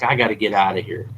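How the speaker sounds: fast, hurried escape line; panicked rush. Retold or imitated. Petrified hunter deciding to flee, rushed panic. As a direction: blurt it out fleeing. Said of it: "he's like".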